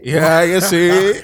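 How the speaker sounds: hype. Pretend. Echoing hype line.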